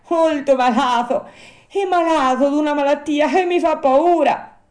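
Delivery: high, loud puppet voice, full of worry; ailing Pinocchio. Acted. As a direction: complain like a sick, frightened puppet.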